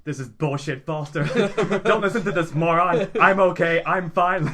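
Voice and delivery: loud, indignant robot buddy; sassy robot sidekick. Retold or imitated. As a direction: brush off the salesman loudly. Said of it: "And the robot goes".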